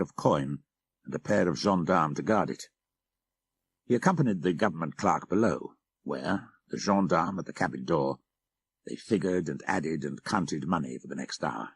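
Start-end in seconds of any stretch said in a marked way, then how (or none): none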